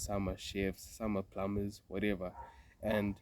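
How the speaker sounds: ordinary talk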